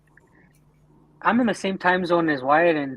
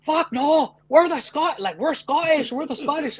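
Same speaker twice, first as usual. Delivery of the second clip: put-on Scottish accent; 10 semitones higher than usual. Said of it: "talking in his Scottish".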